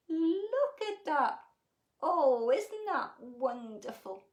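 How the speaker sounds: slow, loud, marvelling awe; delighted wonder. Real, not acted. Storyteller showing children the picture, delighted.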